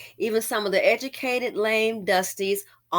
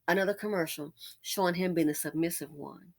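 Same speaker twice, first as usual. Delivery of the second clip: ordinary delivery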